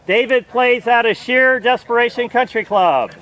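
loud, slow announcer voice; booming tee-box introduction. Acted. Announcing next golfer.

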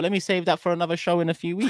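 a hint of a sly inner thought; light mock inner voice. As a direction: tease it as a saved grudge. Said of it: "but I thought".

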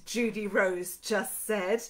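ordinary delivery

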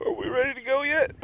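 high voiced dog asking to leave; dog's voice. Owner voicing his dog asking to leave, high and eager.

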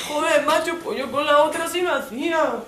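hammed-up macho poet. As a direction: overplay the boyfriend waxing poetic. (novio macarra poniéndose poeta, exagerado y agudo)

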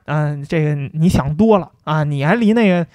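ordinary talk

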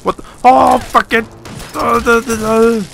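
high, loud, real terrified yells; real fright in every cry; panicked screaming. Real, not acted. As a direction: shriek as monsters swarm you. Attacked by giant bloodworms.

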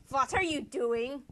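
put-on loud, slow German-accented demand; drawn-out indignant question, German accent; hammed-up German accent, loud; mock German accent. Austria confronting Prussia.

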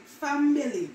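"family" said as fambili, added b; Jamaican pronunciation. Shown as a demonstration. Showing viewers the Jamaican "family", fambili. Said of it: "We put B in it".

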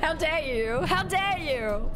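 high cracking laugh into mock outrage; laughing how dare you. Real, not acted. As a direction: scold them while cracking up.

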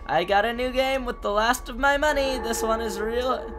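a hint of an eager pen pal; cheery letter-writer. Reads a letter aloud in character.

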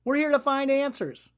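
put-on high, eager girl's cry; excited yell. A narrator's reading. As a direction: burst out with excitement. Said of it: "Excited Lily yelled".

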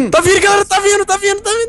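panicked high. Real, not acted. Monster approaching, panicked.

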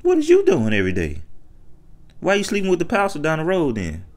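normal talking voice

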